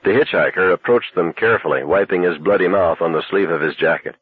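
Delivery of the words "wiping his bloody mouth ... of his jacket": ordinary delivery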